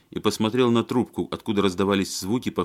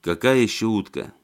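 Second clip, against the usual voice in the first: ordinary delivery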